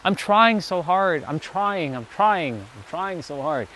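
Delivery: weary, half-hearted trying, fading to almost nothing; lackluster hope. Retold or imitated. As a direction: sigh it out like you've nearly given up. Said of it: "lackluster hope kind of energy".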